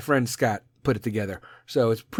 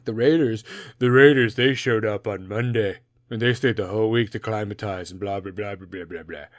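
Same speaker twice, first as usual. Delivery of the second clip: ordinary delivery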